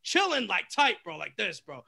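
ordinary talk

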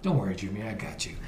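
low, very soft and easy; retold reassurance. Good driver reassuring the nervous car owner, low and soft. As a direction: imitate a cool driver soothing the owner.

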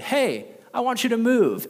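God breezily giving orders; lightly voiced casual God; God's voice. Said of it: "he said".

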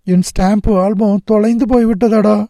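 high, thin-hearted lament; feeble, pitiful voice. A narrator's reading. Nagarajan tells his friend the album is lost, feebly. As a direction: plead weakly over a painful loss. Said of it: "ஈனமான குரலில் சொன்னான்".

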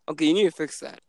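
ordinary talk

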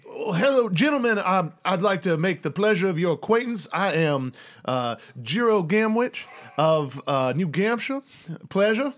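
courtly Southern colonel introducing himself. Acted. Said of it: "like, Southern colonel".

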